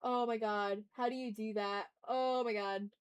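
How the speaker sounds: ordinary delivery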